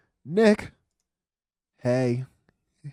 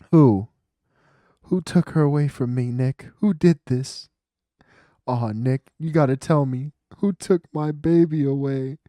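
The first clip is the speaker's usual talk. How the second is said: hammed-up grieving outcry; melodramatic demand; 3 semitones higher, 8 dB louder than usual. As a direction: beg Nick for the culprit. Voicing the client demanding answers.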